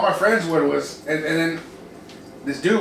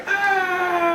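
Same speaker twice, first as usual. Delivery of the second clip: long high held "aah"; imitated party roar on one high note; sustained celebratory wail; crowd cheer; 13 semitones higher than usual. Crowd cheering when he finished drinking.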